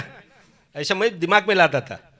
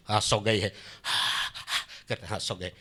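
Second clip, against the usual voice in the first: hushed; 9 semitones lower, 11 dB quieter than usual. Retold or imitated. चुपके से देखता, पत्नी सोई.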